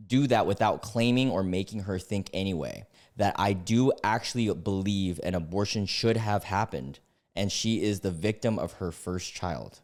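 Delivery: ordinary delivery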